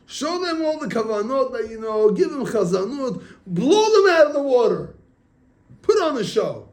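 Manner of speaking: high, building to a loud pitch; storyteller acting a rousing tempter; hyped-up urging. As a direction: egg someone on to show off. Retold Yetzer Hara urging showing off.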